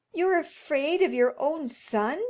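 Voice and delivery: high, loud, accusing cry; indignant cry. Acted. Wife crying out at her husband in scornful disbelief. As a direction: throw it at him in disbelief. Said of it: "she cried".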